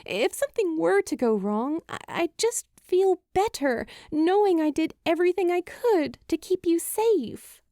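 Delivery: put-on a frightened girl pushing her words out before her voice breaks; Julie, anxious and pleading. Julie fears for him, voice catching.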